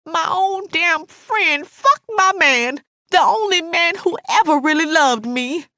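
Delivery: high, wounded, angry wail; anguished, indignant friend; betrayed Alice. A narrator's reading. Narrator voices betrayed Alice.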